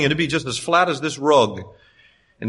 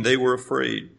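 nonchalant reading; 5 semitones lower, 5 dB quieter than usual. Shown as a demonstration. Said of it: "that's so nonchalant".

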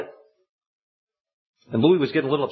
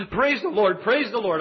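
overjoyed; 7 semitones higher, slower than usual. Retold or imitated. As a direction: shout the good news down the phone. Louis calls overjoyed at night.

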